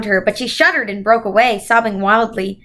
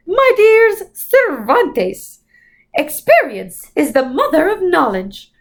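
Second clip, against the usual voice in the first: Mrs Who voice; 6 semitones higher, slower than usual. A narrator's reading.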